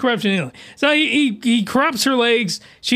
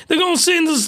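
ordinary talk, nothing marked

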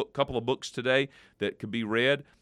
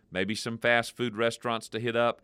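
ordinary talk, nothing marked

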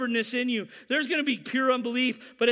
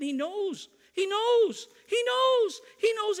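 building praise; 9 semitones higher, slower than usual. Real, not acted. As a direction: climb with every repeat. Preacher building on repeated phrase.

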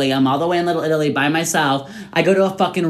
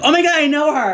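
high-pitched excited squeal of recognition; excited, pitched-up mock greeting; 10 semitones higher than usual. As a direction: gush at spotting someone you know. Acting out excited greeting in crowd.

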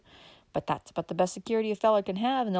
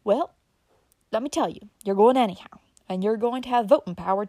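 insisting; 2 semitones higher, 7 dB louder than usual. Acted. Uncle Bobby's voice.

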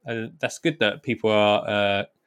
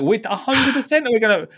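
much higher, louder alarmed outburst; alarmed exclaim; 12 semitones higher, 4 dB louder than usual. Retold or imitated. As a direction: squeak it out in alarm. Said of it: "I'm like".

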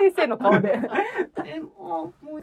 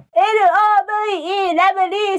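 Fuwa-chan; 3 semitones higher, slower than usual. Pretend. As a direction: put on a cute, piercing squeaky call. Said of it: "ちょっと甲高い声で、".

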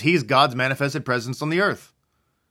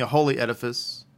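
ordinary talk

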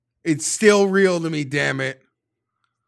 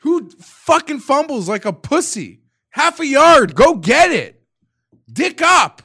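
much higher and louder angry yelling; furious shout; 11 semitones higher, 8 dB louder than usual. Real, not acted. Fan yelling at the running back who fumbled, furious shout. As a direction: bark it at the fumbler.

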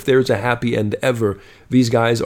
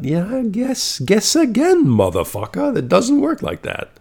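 scornful retort; 11 semitones higher than usual. Real, not acted. Mocking scolding of deluded daters.

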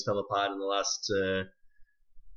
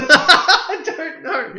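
laughing; 10 dB louder, slower than usual. Real, not acted. Laughing mid-word.

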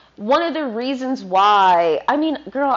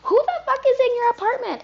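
much higher, half-playful neighbor complaint; mock annoyed neighbor; 13 semitones higher than usual. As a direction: squeak out an imaginary neighbor's gripe. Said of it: "the neighbors are like".

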